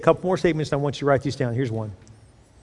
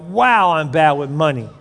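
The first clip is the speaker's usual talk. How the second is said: mock dismay; 5 dB louder than usual. Spender seeing the card bill at month's end, dismayed. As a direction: let the bad news land on "Wow".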